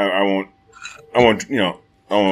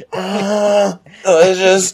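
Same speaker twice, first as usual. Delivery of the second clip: acting tough, tearfully; 9 semitones higher, much slower than usual. Hurt boy eating the apple, mock-brave tearful voice. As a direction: choke out forced approval through fake tears. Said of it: "Trying to act tough, eating the apple".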